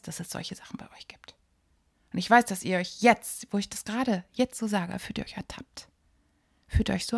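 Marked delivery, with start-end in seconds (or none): 0.0-1.4 knowing whisper. Real, not acted. Teasing knowing aside to listeners. 3.5-5.9 teasing whisper. Real, not acted. Teasing listeners caught out.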